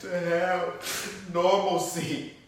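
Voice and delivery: high, strained voice close to breaking; real tearful anguish. Real, not acted. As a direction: push the words out through tears. Tearful account of tormenting voices.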